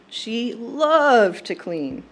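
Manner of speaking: "loved" stretched long and punched; stress on loved. Shown as a demonstration. Granddaughter stressing how Gram "loved" cleaning, "loved" drawn out. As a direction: draw out "loved" with relish for emphasis. Emphatic repeat of previous sentence.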